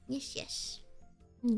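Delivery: ordinary talk, nothing marked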